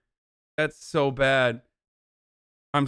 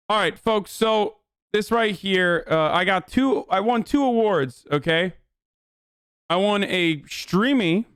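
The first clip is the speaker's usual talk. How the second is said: higher, showy host voice, slowing on the big news; hyped-up announcer; 6 semitones higher than usual. Acted. Streamer showing off his awards to chat, hammed-up announcer voice.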